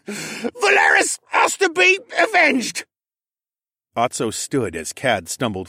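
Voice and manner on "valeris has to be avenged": high, loud, slow cry for revenge; vengeful scream. Acted. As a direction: scream it in agony and rage. Wounded mage screams for revenge.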